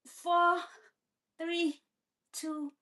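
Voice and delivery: loud, strained, holding a plank; straining in a plank. Real, not acted. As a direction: push through the effort, counting down. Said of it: "I'm sweaty".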